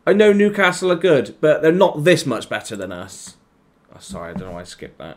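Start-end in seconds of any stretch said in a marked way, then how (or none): 0.1-3.4 high and loud, exasperated; annoyed rant. Real, not acted. Gamer venting about losing to Newcastle, angry. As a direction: vent at the team. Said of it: "I'm just getting annoyed now".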